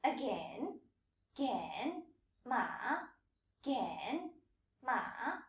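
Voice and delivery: "gen" and "ma" each dipping, then rising. Shown as a demonstration. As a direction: copy the questioning dip-and-rise of "Again" onto "ma". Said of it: "Notice the dipping and rising tone".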